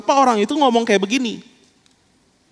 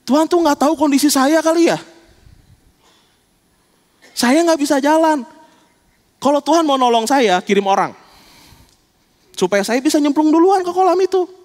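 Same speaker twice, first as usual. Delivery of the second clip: complaining lame man; 8 semitones higher than usual. Pretend.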